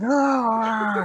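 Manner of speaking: long, high, loud mock scream; sustained startling yell; jump-scare scream. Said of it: "he jump scared everybody".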